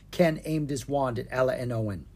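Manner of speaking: ordinary delivery, nothing marked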